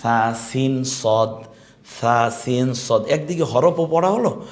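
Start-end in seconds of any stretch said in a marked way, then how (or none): 0.0-3.0 সা সিন সদ, drawn out very slowly; slow drill. Shown as a demonstration. Teacher slowly modelling letters for students. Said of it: "দেখুন, হয়ে যাবে".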